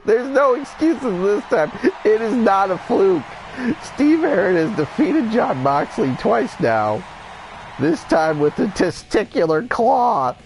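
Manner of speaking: high, emphatic victory call; triumphant announcement. Real, not acted. Commentator declares Steve beat Moxley twice, triumphant. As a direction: proclaim the upset with excitement. Proclaims the upset win.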